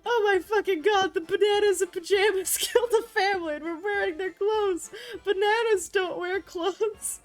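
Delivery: high, loud hysterical outburst; hammed-up scream. A narrator's reading. Narrator screaming that bananas in pajamas killed a family, high hysterical scream. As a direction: shriek it in horrified disbelief. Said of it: "I screamed".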